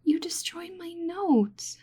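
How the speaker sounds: high, slow whispered lament; mock despair. Researcher grieving the notes the adventurer ruined, slow and whispered. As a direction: wail it in hushed disbelief.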